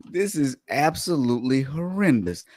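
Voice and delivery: ordinary delivery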